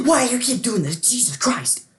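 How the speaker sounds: gasp, then a high, loud outburst; exasperated yell. Retold or imitated. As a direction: shout it like you're fed up.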